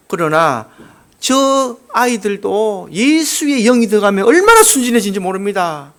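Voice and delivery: normal talking voice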